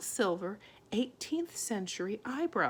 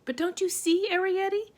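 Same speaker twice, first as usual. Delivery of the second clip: higher, louder, breathless; gasping plea; 12 semitones higher, 7 dB louder than usual. A narrator's reading. Homily pleading with Arietti, breathless. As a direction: gasp out the plea. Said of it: "gasped Homily".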